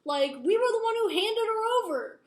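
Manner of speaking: loud, high-pitched retold rant; acted outrage. Brother demands why Jace saved her, loud acted fury. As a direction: raise voice and pitch, indignant, as in a quarrel.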